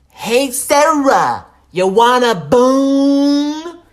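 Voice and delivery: very slow, high, full-voiced holler; drawn-out drunken shout, last word stretched long; window-rattling yell. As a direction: bellow it so every neighbour hears. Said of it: "I yelled loud enough that if anyone had their windows open".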